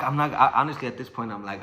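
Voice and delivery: ordinary delivery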